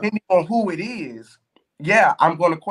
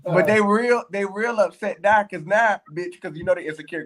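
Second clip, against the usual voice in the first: ordinary talk, nothing marked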